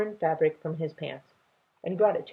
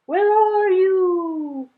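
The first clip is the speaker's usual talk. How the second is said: slow, high, drawn-out call; 14 semitones higher, 8 dB louder, much slower than usual. Acted. Grandfather calling for the lost children, drawn out. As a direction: stretch the call into the distance. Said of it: "the voice cried".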